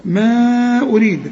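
MA in ما held long before أريد; separated madd. Shown as a demonstration. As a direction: stretch the vowel of ما, slow and even. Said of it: "مد منفصل".

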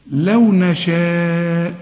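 لو نشاء with the madd on نشاء held for four counts, slow. Shown as a demonstration. Teacher drilling a student on recitation, holding the madd long. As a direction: stretch the vowel of نشاء for four full beats. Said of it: "شوف المد أربع حركات".

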